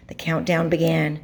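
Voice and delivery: ordinary delivery, nothing marked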